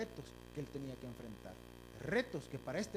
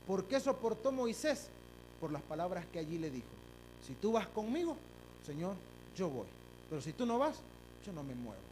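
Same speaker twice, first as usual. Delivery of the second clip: higher, louder preaching; fiery preaching; 3 semitones higher, 4 dB louder than usual. Acted. Preacher quoting Moses to the church, fervent. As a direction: proclaim it.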